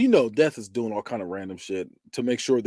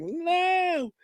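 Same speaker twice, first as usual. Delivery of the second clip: high, drawn-out mock "no"; watching in dread; 5 dB quieter than usual. Viewer watching the death coming, high mock wail. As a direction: squeal it like a squeamish viewer. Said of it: "That's how you watching it".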